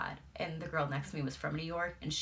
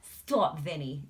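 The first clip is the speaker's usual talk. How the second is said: swat him off, flirty; mock New York wife; playful scolding; 8 dB louder, much slower than usual. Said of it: "she was like".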